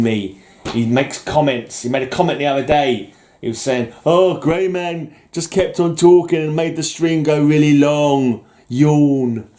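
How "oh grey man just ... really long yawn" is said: imitating a whiny complaint, loud and drawn out; hammed-up mocking quote. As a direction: overplay a bored critic's moan. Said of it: "I'm hamming it up".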